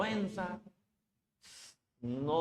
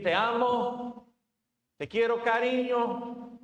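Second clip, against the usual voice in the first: slow, higher, drawn-out loving tone; tender words; 7 semitones higher, 4 dB louder, slower than usual. Retold or imitated. Preacher imitates a husband's sweet talk, slow and tender. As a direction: croon it like sweet talk to a spouse. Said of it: "Podemos decir".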